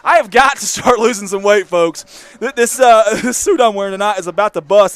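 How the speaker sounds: laughing through self-mockery. Real, not acted. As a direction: laugh at your own suit.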